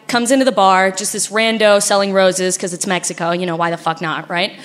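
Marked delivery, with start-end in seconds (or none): none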